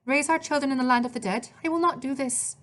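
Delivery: put-on high-pitched, indignant Italian-accented refusal. A narrator's reading. Maria objecting to raising children in the underworld, Italian accent. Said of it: "she spoke with an Italian accent".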